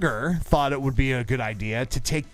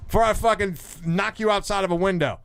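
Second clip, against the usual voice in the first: lightly acted higher-pitched threat; irate boss; 6 semitones higher than usual. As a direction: imitate a boss throwing someone out. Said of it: "And he's like".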